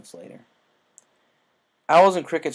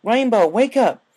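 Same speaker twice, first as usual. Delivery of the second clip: louder, higher, slow urgent call; shouting her friend awake; 11 semitones higher, 11 dB louder than usual. A narrator's reading. Applejack shouts Rainbow awake, urgent.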